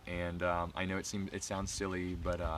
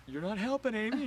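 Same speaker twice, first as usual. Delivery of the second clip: re-enacted protest; 13 semitones higher than usual. Freaked-out dancer to Amy, high mock protest. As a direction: protest to your friend in pitched-up mock distress. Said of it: "and I'm like".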